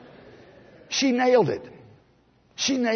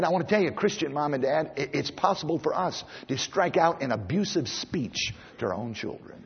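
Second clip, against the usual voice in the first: ordinary talk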